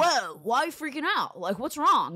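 high, loud stoner-husband voice; imitating laid-back husband. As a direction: play the baffled California dude. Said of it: "how good your impression is of him".

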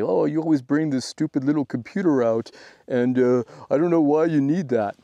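mock a miserable ham radio hobbyist complaining; sad ham impression. Grumbling ham mocking the little computer, sad put-on voice. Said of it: "sad ham, uh, impression".

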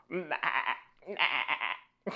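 wavering playful baa; sheep bleats. Pretend.

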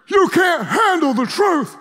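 high shouted movie line, far from his own voice; Jack Nicholson shout. Retold or imitated. Preacher quoting the movie line, in a high shout. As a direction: bark it like the angry colonel. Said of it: "There's a line Jack Nicholson says".

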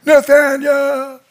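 high, long sustained call; calling his name. Retold or imitated. Phil looking for his buddy Nathaniel, calling out. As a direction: call out as from a distance. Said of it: "he hears a voice calling him".

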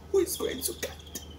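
whispered lament; grief-choked whisper. Real, not acted. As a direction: let the voice fail into a whisper. Grieving for the widows.

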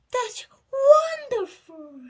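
high, loud, drawn-out delight; gush, stretching the first syllable of wonderful; gushing praise. A narrator's reading. Mom praising a soggy picture, loud gushing delight.

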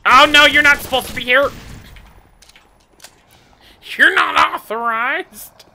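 mock guard voice. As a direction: play a panicked guard. Voicing a game guard.

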